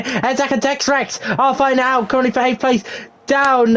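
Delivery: high-pitched, urgent live racing commentary; excited race play-by-play. Real, not acted. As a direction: call the restart action at full tilt.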